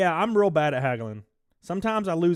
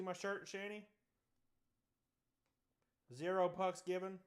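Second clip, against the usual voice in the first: faint, higher voice away from the mic; off-mic call; 5 semitones higher, 13 dB quieter than usual. Real, not acted. Streamer showing Shanny a shirt, off-mic call. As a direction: call softly from a step back.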